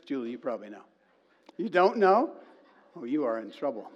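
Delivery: usual speaking voice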